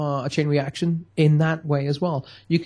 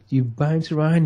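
normal talking voice

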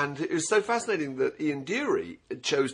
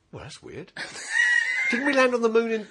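high, laughing mock astonishment; mock bewildered commuter; 8 semitones higher than usual. Baffled commuter, laughing at the strange carriage. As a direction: act the baffled passenger staring around.

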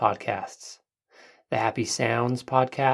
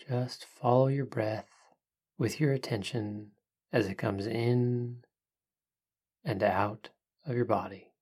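soft spa whisper; 5 dB quieter than usual. Acted. Leads a breathing meditation.

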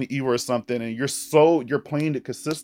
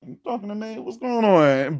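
hammed-up tipsy confusion; drunk voice; 8 semitones higher than usual. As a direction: drunk self talking back to the screen. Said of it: "I'd probably be like".

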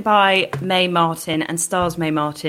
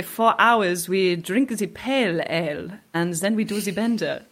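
mock French accent; 3 semitones higher than usual. Chic French people discussing an English habit, mock French accent. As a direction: play a chic French person, a French accent only lightly put on. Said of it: "Sounds a bit saucy in that accent".

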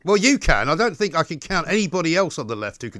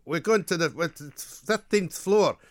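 lightly imitated Scottish accent; 3 semitones higher than usual. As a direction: mimic Scottish comics repeating themselves to a lift. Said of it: "two Scottish comedians in a lift".

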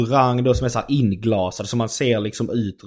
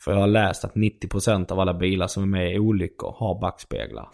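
normal talking voice

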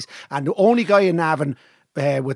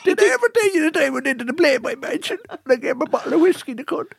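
put-on laughing old yarn; 8 semitones higher than usual.

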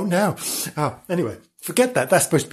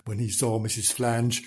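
ordinary delivery

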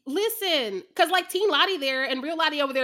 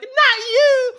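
high, loud wail at the screen; mock anguished cry; 12 semitones higher, 12 dB louder than usual. Fan re-enacts reaction to character's death, loud mock anguish. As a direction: cry it out in hammed-up dismay. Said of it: "I'm like".

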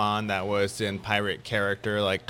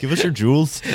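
a hammed-up, bad French Canadian pirate accent; mock French Canadian threat; terrible French Canadian accent; 4 semitones higher, 5 dB louder than usual. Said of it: "that's a terrible French Canadian accent".

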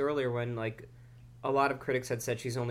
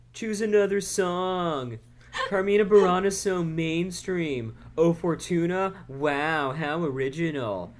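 hipster voice; 2 semitones higher, 5 dB louder, slower than usual. Retold or imitated. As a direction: sneer like a music snob. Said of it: "sounds like a fucking hipster when he's like".

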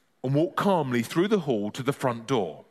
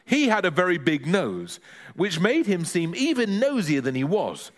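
usual speaking voice

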